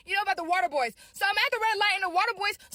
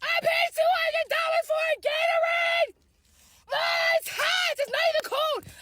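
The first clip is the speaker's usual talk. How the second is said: outraged; 8 semitones higher than usual. Real, not acted. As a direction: yell it in disbelief. Outraged at scam price.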